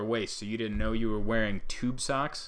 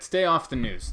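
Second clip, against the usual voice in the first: "Stay off the news." said louder, punched on "Stay"; firm advice; 4 semitones higher, 6 dB louder than usual. Shown as a demonstration. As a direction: hit "Stay" hard as a warning. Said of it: "my only piece of positive advice".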